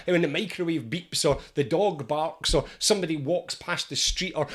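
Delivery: usual speaking voice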